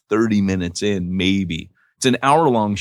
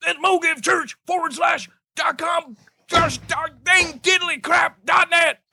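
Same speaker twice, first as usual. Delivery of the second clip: high shouting drill sergeant barking orders. Acted. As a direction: scream orders at a cowering private. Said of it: "I'll be the drill sergeant".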